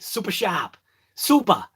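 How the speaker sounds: "Super sharp. Super." with Boston dropped R's; Boston accent. Shown as a demonstration. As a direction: supa shahp, supa, as a Bostonian says it. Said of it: "There's no R".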